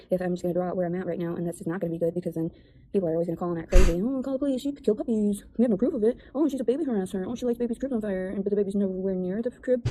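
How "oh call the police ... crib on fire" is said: mocking hysterical callers. Mimicking people who call and act crazy, higher mocking voice. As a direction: mimic outraged callers piling on accusations.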